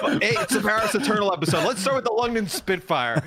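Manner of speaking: mock announcer. Mocking the host's opener.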